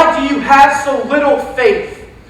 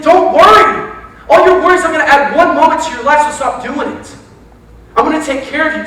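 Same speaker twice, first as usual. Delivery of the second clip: higher, louder proclaiming voice as Jesus; Jesus calling out in passion, higher and louder; passionate Jesus; 5 semitones higher, 4 dB louder than usual. Acted. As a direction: preach it as a fiery Jesus.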